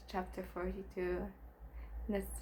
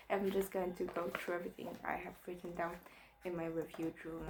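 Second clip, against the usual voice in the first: ordinary delivery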